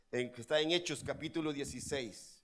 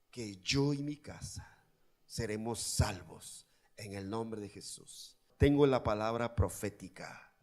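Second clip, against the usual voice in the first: guided prayer; 6 semitones lower, 5 dB quieter than usual. Acted. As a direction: lead them quietly into prayer. Leads congregation in prayer.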